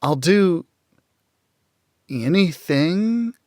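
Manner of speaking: anything drawn out slow and high, leaned on; stress on anything. A narrator's reading. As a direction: linger on anything as a clumsy come-on. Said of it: "She emphasized that last word".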